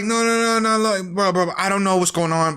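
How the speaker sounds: high, hurried mock protest; defensive. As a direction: rattle off denials, starting high and easing down. Bear protesting Ian's accusation.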